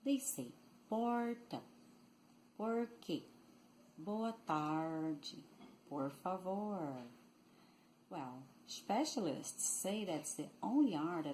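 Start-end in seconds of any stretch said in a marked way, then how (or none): 0.9-7.0 "porta, boa tarde" with curled-back country R; caipira retroflex R. Shown as a demonstration. Teacher showing São Paulo country accent, "porta" with retroflex R. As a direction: curl the tongue for the R in "porta", "tarde", "favor". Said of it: "retroflexo, retroflex, caipira or country accent".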